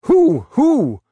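high, loud eager questions; many voices demanding a name; crowd calling out. Acted. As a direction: cry out to find out who. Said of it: "from twenty voices".

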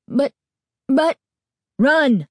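high loud frightened scream. A narrator's reading. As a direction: scream at her to flee. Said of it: "he screamed".

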